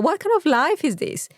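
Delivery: ordinary talk, nothing marked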